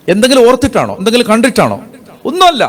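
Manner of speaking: a preacher's rhetorical questions, sung out high. Real, not acted.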